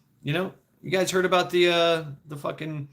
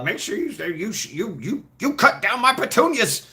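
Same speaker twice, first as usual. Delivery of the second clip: put-on cranky old man; 7 semitones higher than usual. Old man scolds yard kid.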